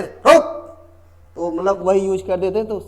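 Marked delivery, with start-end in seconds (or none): 0.0-0.6 sudden high, loud bark; mock grandfather's scolding order to halt; scolding shout. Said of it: "वो अपनी डांट से छोटे बच्चों को". (डाँटते हुए ऊँची, तेज़ आवाज़)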